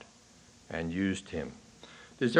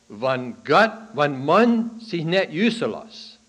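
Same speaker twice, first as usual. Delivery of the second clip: bishop's saying in Dutch, louder and higher, slow; Pennsylvania Dutch quote; 8 semitones higher, 11 dB louder than usual. Retold or imitated. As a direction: preach the old Dutch saying loud. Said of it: "I'm gonna say it in Dutch first".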